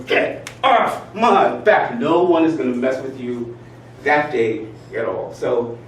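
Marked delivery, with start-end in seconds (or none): 0.0-2.0 slow, drawn out and louder; forceful. Retold or imitated. Walking around the office at a pushy supervisor, forceful. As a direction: stretch it out like the exercise. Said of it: "walking around the office going".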